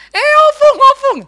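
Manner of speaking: put-on high, loud childish insistence; small child insisting. Toddler insists to grandma that she did it, high childish voice. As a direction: keep pointing like a stubborn toddler.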